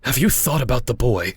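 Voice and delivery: indignant reproach; angry confrontation. Acted. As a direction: confront her about the boy, upset. Angry man confronts woman over son.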